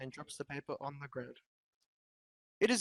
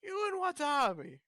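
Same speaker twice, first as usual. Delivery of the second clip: much higher mock ogre voice; sarcastic ogre, pitched way up; Shrek voice; 12 semitones higher than usual. Reading Shrek's lines for friends, high mock ogre voice.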